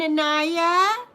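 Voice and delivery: ordinary delivery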